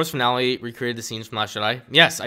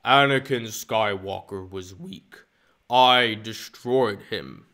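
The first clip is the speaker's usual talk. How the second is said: very slow, heavy Vader-like delivery; slow villain taunt; 4 dB louder, much slower than usual. Pretend. Streamer as Vader in the duel, slow and heavy. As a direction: weigh each word with cold menace.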